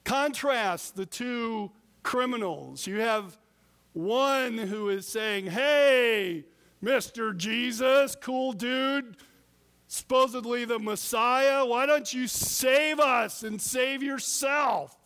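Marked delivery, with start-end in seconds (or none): 5.5-14.9 loud, jeering taunt at the man on the cross; sarcastic heckle from the cross, louder; mocking shout. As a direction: yell it like a scoffing criminal. Mocking criminal voiced in contrast.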